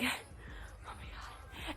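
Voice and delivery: barely audible, slow whisper; tearful fear. Retold or imitated. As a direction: whisper it as if crying from fright. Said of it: "I was crying because I was scared".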